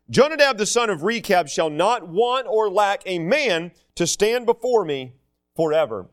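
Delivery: preacher reading the Bible; scripture reading. Acted. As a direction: proclaim the verse from the pulpit.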